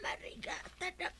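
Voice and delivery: faint breathy whisper, fading away; barely audible final whisper; dying whisper. Acted. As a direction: whisper the last words as life slips. Dying man's last words.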